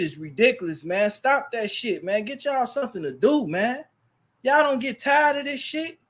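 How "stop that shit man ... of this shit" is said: higher, rising exasperated scold; higher, fed-up rebuke. Real, not acted. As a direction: call out the trolls, fed up.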